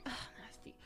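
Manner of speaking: whispered, grossed-out ugh; disgusted whisper. Retold or imitated. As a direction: breathe it out in disgust. Disgusted at the puke.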